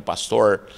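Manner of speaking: "pastor" ending on a retroflex American r; mimicking the caipira r in "pastor". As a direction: curl the tongue back on the r of "pastor". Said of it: "Parece o R americano".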